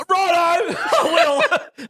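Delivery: high, laughing "righto"; laughing reply. Retold or imitated. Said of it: "I said".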